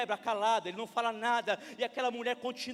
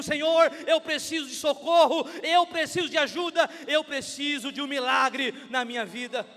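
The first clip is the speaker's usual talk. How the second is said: loud, desperate prayer, acted; preacher's shouted plea; crying out; 8 dB louder than usual. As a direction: cry to God for help at full volume. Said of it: "quando você grita".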